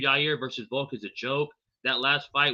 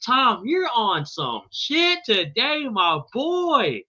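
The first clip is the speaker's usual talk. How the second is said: hyped drawl; 8 semitones higher, much slower than usual. Real, not acted. Reacting to a chat message.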